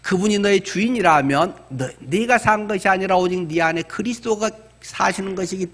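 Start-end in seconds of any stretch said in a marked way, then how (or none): none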